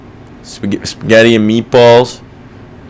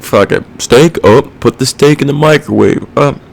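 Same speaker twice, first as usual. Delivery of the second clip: mock clueless cook; 6 semitones higher than usual. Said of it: "and it's like".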